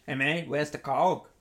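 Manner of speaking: a light put-on Aussie accent; Australian accent. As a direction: ask for the Coke like a servo customer, lightly Aussie. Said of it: "say something to me".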